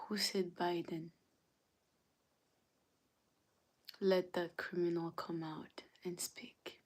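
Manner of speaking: hushed mock-menacing interrogation; quietly hunt the culprit, playfully. Mock interrogation of Biden voters.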